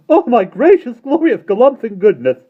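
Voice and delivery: high, loud roar of laughter. A narrator's reading. Mister Wig roaring with laughter at the children. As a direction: bellow it through tears of joy. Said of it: "roared Mister Wig".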